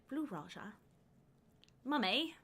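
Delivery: quiet, put-on character accent; Blue Raja accent. Fan quoting a favorite movie hero, in his put-on accent. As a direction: lightly mimic the hero's affected accent. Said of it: "Can you do the accent".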